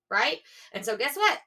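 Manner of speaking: usual speaking voice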